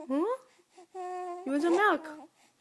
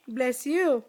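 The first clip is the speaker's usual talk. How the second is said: normal talking voice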